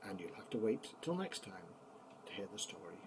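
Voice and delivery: quick breathy bedtime whisper. Acted. Grandpa whispering the ending to the grandchildren at bedtime.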